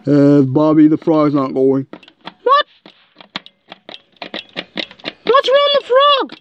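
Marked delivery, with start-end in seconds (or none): 1.9-6.3 high, startled question about the toy; alarmed surprise. Real, not acted. Frog toy suddenly stopped.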